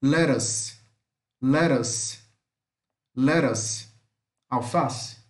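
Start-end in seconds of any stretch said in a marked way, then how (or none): 0.0-3.9 lettuce with the TT as a fast tapped R; TT as quick R. Shown as a demonstration. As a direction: say lettuce like "LE-rus". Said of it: "como se fosse um R".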